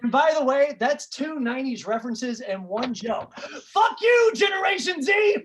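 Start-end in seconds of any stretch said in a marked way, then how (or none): 3.4-5.4 high, loud mock-furious shout. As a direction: yell it at the kids in the crowd. Comic outburst at young crowd.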